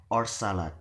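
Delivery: "or salad" closing the choice with a fall; falling intonation. Shown as a demonstration. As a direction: drop the pitch on "salad". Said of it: "then falling".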